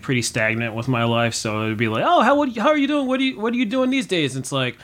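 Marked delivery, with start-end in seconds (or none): 2.0-4.3 high, sing-song fake politeness, quick at the end; much higher mock-friendly chatter; mock cheery greeter. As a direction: chirp it like a nosy acquaintance. Mock small-talk greeting.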